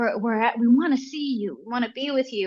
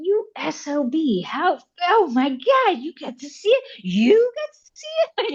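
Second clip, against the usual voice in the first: envious inner outburst; 9 semitones higher than usual. Retold or imitated. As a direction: drag it out high like a friend who got there first. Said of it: "and I'm just thinking".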